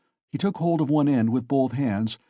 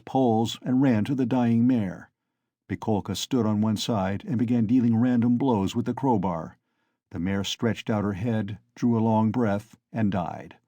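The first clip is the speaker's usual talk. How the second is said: plain reading voice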